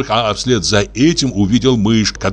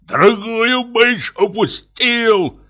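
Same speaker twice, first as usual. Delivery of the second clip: very high, drawn-out acted yell; slow, high-pitched cry of indignation; furious bawl; 13 semitones higher, much slower than usual. A narrator's reading. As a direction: wail it out in outrage. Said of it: "заорал Пётр Демьяныч".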